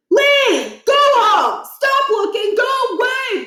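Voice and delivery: high, loud barked orders; frantic shouting. Acted. Principal yelling students off school grounds, loud. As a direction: drive them all away.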